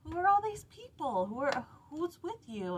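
high, loud, surprised brother; hammed-up astonished Esau. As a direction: burst out in amazement at the crowd. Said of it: "And he goes".

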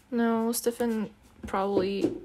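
ordinary talk

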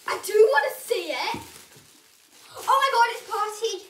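loud eager excitement; delighted opening a present. Real, not acted. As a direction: burst out thrilled.

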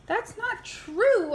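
high, slow, offended protest; outraged denial. Acted. Reader insisting he's no monkey, outraged. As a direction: stretch it out in disbelief.

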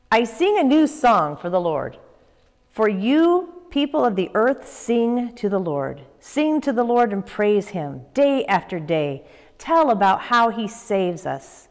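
put-on ringing Bible reading for children; scripture reading. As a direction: read the psalm out to the kids with fervour. Reads the psalm aloud.

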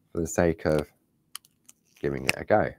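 ordinary talk, nothing marked

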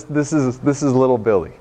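ordinary talk

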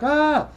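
short high-pitched "ah"; exasperated little yelp, played for comedy; mock panicked yelp. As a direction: cry out high in mock dismay. Retelling his panic at rush orders.

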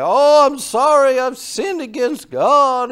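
high, loud, hammed-up weepy plea; mock crying plea. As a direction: imitate his fake sobbing apology, slowly. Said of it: "he'd start crying".

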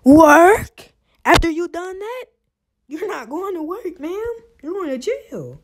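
high, loud disbelief; incredulous mockery. Real, not acted. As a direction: scoff at her, voice up high. Mocking her, pitch way up.

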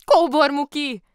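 high, very loud exclamation of the name; surprised cry. A narrator's reading. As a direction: cry out in astonishment. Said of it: "kiáltott fel Lotta".

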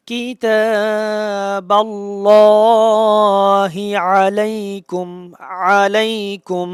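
slow, drawn-out chanted recitation; tajweed recitation. Shown as a demonstration. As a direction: chant it slowly, lam heavy. Recites the verse phrase. (ধীর সুরে তিলাওয়াত)

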